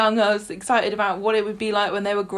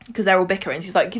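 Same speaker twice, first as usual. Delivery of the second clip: normal talking voice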